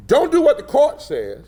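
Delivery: high, emphatic imitated order; quoted instruction. Party director instructing two board members, high and emphatic. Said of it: "sent a letter saying to those".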